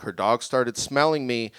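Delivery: ordinary delivery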